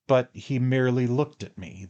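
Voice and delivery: ordinary delivery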